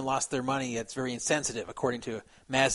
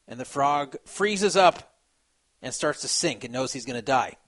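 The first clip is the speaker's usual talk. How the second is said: ordinary delivery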